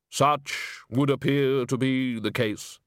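slow, dry Death voice; bass voice. Acted. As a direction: speak as unhurried Death. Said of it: "you do have a very good bass voice".

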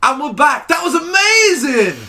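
loud, high, slow gushing; fake excited return. Mock excitement pretending they heard the album, loud and high. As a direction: pretend you loved the whole hour. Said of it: "I just wanna trick everyone".

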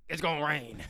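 high, loud put-on forecaster; weatherman voice. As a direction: announce it like a cheesy weatherman. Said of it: "Cameron Smith with the weather".

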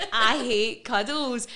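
said loud and slow through real laughter; rueful laugh while admitting it. Real, not acted. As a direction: laugh the confession out. Laughing at her own aversion.

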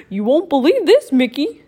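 excited goofy friend voice. Acted. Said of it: "said Goofy".

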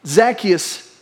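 ordinary delivery

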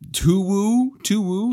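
high hooting owl call; playful high owl hoot. Acted. Imitating owl calls.

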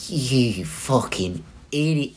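put-on slow, drawn-out British-accented insult; imitating a drunk friend in a put-on British accent. Drunk friend Nate calling him an idiot, mock British accent. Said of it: "I don't know why he's British".

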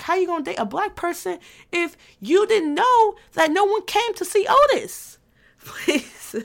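high, incredulous challenge; scoffing at the clueless dater; mock outrage. Real, not acted. Scoffing at anyone who never learned the line. Mock indignant rhetorical question.

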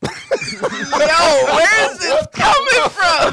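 very high, drawn-out yell through laughter; incredulous shout. Real, not acted. Co-host reacting to the absurd beard story, incredulous shout.

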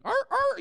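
high, loud barking seal noises. Retold or imitated. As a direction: bark out like a seal. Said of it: "you know, like that".

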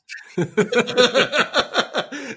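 bursting into a high laugh; laughing at his own punchline; real laugh. Real, not acted. Laughing as he tells how the owner hired him, a high real laugh.

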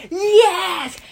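high, loud, drawn-out mock cheer; excited crowd cheer. Fans answering the YouTubers' chant, hammed-up cheer. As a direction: cheer like a thrilled fan crowd.

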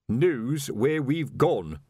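very slow, weighty finish; solemn. Acted. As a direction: lay it down gravely. Colon's solemn answer.

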